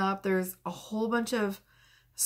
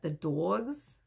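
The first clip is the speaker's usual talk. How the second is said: mock New York dogs; 4 dB quieter than usual. Shown as a demonstration. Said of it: "Why did I say dogs like that?".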